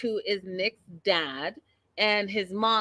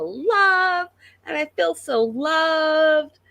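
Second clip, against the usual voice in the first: mock crying; 8 semitones higher, 4 dB louder, slower than usual. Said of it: "She's crying, but she's like".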